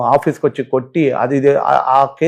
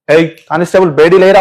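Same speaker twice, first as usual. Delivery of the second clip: retold shout for constable; 3 semitones higher, 7 dB louder than usual. Judge calling the constable for handcuffs, loud retold shout.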